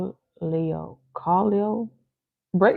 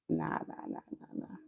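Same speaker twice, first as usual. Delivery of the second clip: breathy whispered no no no; whispered nah; 17 dB quieter than usual. Real, not acted. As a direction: whisper the refusals under your breath. Hushed refusal.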